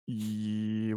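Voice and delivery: ordinary talk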